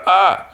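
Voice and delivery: high, loud yelp of fright; high startled cry. Retold or imitated. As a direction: yelp as the arrow flies. Owl dodges an arrow.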